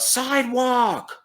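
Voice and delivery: high, drawn-out mock yell; mimicked holler, long and high-pitched; imitated yell. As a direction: call it out high and stretched, without full volume. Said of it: "And he yells out".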